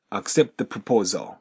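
"accept the" with the T absorbed into the; T swallowed. Shown as a demonstration. Teacher demonstrates the swallowing the T. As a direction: let the eat the T in "accept the". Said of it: "後ろのtheが前のTを飲む形で".

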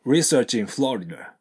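"Research in" joined as "resear-chin" before "Florida"; linked research in. Shown as a demonstration. Teacher modelling the linked phrase. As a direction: run "Research in" together. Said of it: "こんな感じですね".

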